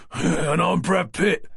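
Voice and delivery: slow, dry and mocking; sardonic. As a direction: drag it out with sarcasm. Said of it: "came the man's sardonic response".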